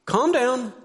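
high, abrupt order; sharp command. Shown as a demonstration. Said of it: "just hear that difference".

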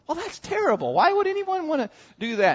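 very high, scandalized voice; squeaky-high mock disapproval; mock horrified. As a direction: imitate a prim, appalled listener. Mimics shocked, disapproving listeners.

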